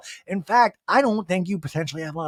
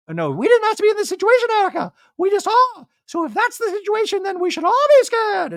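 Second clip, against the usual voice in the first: very high, loud, squeaky voice; Dorit impression; 6 dB louder than usual. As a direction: squeak it like a posh socialite.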